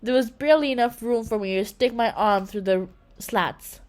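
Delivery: ordinary delivery, nothing marked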